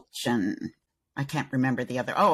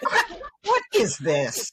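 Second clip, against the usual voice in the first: high, slow, incredulous complaint; mock indignation; 9 semitones higher, slower than usual. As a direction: play it affronted, drawing the words out. Keeps up mock outrage at billing.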